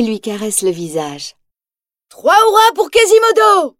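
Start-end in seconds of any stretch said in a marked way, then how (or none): 2.1-3.7 very high, loud joyful cheer; cheering shout. A narrator's reading. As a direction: cheer him in triumph. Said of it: "commence à hurler la foule".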